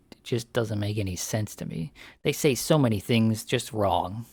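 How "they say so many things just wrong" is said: ordinary delivery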